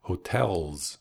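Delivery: "hotels" closing on a buzzing Z; buzzing final S. Shown as a demonstration. Teacher showing learners the bee sound, buzzed S in "hotels". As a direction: let the S of "hotels" buzz like a bee. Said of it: "final S sounds like a bee".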